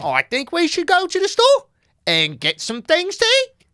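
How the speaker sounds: high, slow drifting accent; an Australian accent that slides off. Shown as a demonstration. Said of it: "now it's coming to something else".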